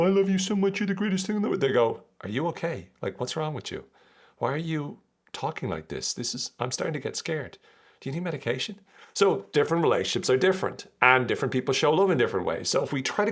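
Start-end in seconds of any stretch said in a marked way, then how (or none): 0.0-1.6 high, sugary declaration; mock gushing. Partner suddenly gushing love, mock sugary and high. 2.2-8.8 softer, puzzled concern; mock worried partner. Partner alarmed by the gushing, softer and worried.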